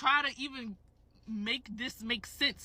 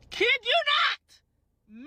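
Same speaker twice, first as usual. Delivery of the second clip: high, drawn-out cry of disbelief; exasperated exclamation; 13 semitones higher, 4 dB louder, much slower than usual. Real, not acted. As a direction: exclaim it high and slow, scandalized. Indignant retelling of the interruption.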